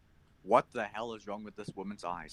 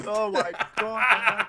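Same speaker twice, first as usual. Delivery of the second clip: slow, loud, through laughter; can barely get it out for laughing; laughing hard; 11 semitones higher, 10 dB louder, much slower than usual. Real, not acted. Helpless laughter.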